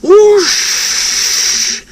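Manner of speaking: high, breathy hissing whoosh; hissed whoosh. A narrator's reading. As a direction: hiss it like steam escaping. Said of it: "he hissed".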